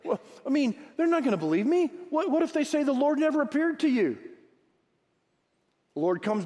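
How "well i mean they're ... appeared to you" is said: high, pleading complaint to God; worried excuse-maker, raised pitch; whiny Moses. Retold or imitated. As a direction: voice Moses backing out, pitched up and anxious. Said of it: "Moses says to the Lord".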